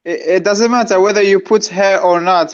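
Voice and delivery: ordinary talk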